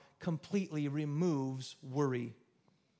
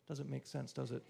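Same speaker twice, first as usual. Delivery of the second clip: ordinary delivery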